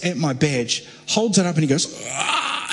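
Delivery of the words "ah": startled cry, lightly acted; retold scream. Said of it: "And he screams".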